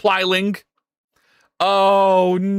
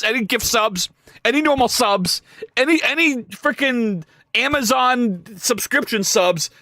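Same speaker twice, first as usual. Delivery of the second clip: usual speaking voice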